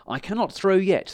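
shouted. A narrator's reading. Stan shouting to the dragon. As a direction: call out to stop him. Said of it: "he shouted to the dragon".

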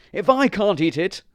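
high, loud spite; stubborn dog refusing to share; selfish dog. A narrator's reading. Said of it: "said the selfish dog".

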